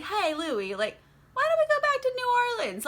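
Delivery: high, fast coaxing Armand voice. Retold or imitated. Armand coaxing Louis back to New Orleans, high and quick. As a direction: pitch it up and rush the invitation. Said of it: "Armand is like".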